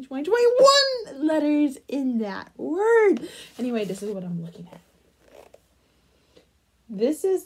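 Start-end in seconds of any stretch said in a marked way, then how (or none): 0.0-3.3 incredulous count end. Real, not acted. Finishing count of letters.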